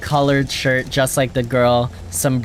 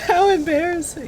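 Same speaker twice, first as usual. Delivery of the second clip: laughing. Real, not acted. Laughing at the failed throw.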